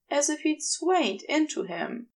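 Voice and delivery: ordinary delivery, nothing marked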